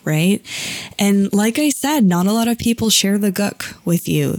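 ordinary delivery

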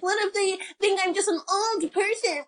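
high, whiny mock worrier; insecure artist boyfriend. Artist fretting to his girlfriend before his exhibition, whiny.

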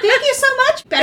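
very high, loud sugary thank-you; fake sweet voice. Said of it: "I love the voice she puts on".

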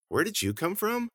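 put-on high-pitched girl's question; high, curious female character; surprised girl. A narrator's reading.

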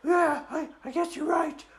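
high, hesitant dragon voice; reluctant girl dragon. Acted. Dragon concedes to the kids, high hesitant admission. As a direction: give in haltingly in a high girly voice. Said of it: "And she said".

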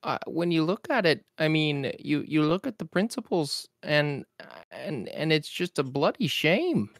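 exasperated professor lamenting the world; Jordan B. Peterson impression. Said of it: "[name]'s impeccable per-, impression".

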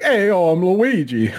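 slow, lifted mock game-character greeting; Luigi. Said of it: "I can picture Luigi being like".

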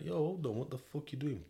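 startled outburst. Retold or imitated. Prisoner locked in his cell by three inmates, startled angry outburst. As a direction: jump up in shock at being shut in.

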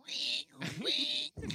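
sing-song, very high and loud ring ring; ringing call. Retold or imitated. Friend mimicking Finny's call, squealed very high and loud. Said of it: "He said".

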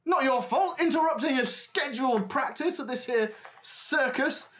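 put-on high, incredulous old showman; indignant ringmaster. Ringmaster to the one who hurt his acrobat, indignant. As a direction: echo the excuse in high-pitched outrage.

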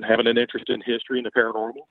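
usual speaking voice

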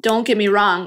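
normal talking voice

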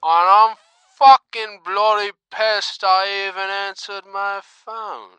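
loud, angry British rant; furious caller with British accent. Acted.

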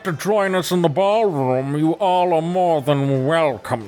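a one-eyed beast purring out a slow welcome; monster's voice. Acted. The orb creature invites the party into its domain, high and slow. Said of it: "the creature yells loud enough for all of you to s-, to hear".

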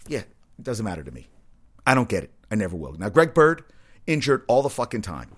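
ordinary talk, nothing marked

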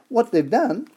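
normal talking voice

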